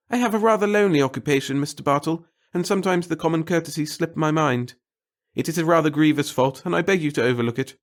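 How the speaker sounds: high, loud, fretful little man; fussy nervous clerk. A narrator's reading. The little clerk fretting to the reporter about his boss, high and anxious. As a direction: babble anxiously to please the reporter.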